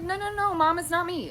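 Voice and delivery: high-pitched, insistent denial; retold protest. Retold protest to worried mom.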